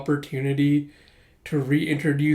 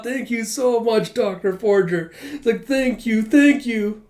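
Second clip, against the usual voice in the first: higher, hammed-up tearful thanks; imitating a blubbering grateful man, higher; mock bawling; 7 semitones higher, 4 dB louder than usual. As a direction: sob out thanks, overplayed. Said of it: "Corey just bawling".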